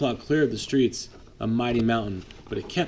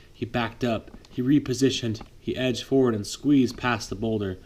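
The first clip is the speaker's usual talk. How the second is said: plain reading voice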